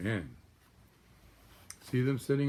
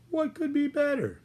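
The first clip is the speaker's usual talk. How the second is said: much higher, fluty duck-mother voice; Mrs Mallard voice. Acted. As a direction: lift it way up like a cheerful mother duck. Mrs Mallard voiced, delighted question.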